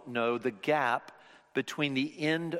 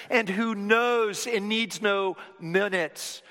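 raised, louder preaching voice, building; preaching at full force; 8 semitones higher, 6 dB louder than usual. Acted. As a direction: preach it out with conviction.

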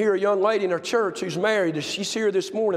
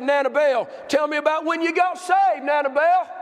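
put-on raised voice, higher, spelled out for an old ear; 8 semitones higher than usual. Preacher asks Nana Bell about being saved, raised voice. Asking elderly woman loudly.

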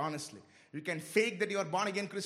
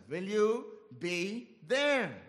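slow searching question; 2 semitones lower, much slower than usual. Shown as a demonstration. Preacher pressing the congregation to examine themselves, slow and grave. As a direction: let the question hang, slow and heavy. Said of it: "Examine yourself".

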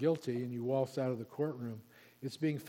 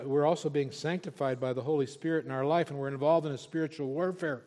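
ordinary delivery

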